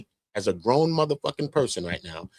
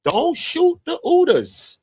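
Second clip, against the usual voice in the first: much higher, slow, louder squeal; Cat Williams impression; 5 dB louder, slower than usual. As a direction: squeal it out slowly like the comedian. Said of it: "That's Cat Williams".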